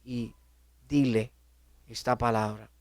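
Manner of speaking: lightly voiced, hushed inner command; inner voice of God. God speaking inside him in the dream, lightly voiced and hushed. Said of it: "en esa voz interna".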